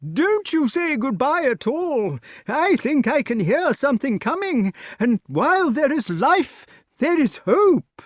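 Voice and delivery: very high-pitched king's voice; high character voice calling out; shouting old king. A narrator's reading. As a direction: call out high, urging them on. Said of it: "shouted King Pellinore".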